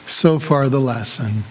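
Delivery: ordinary delivery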